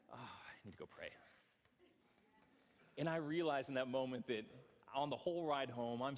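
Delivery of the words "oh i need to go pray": quiet wife reply. Retold or imitated. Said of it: "she's like".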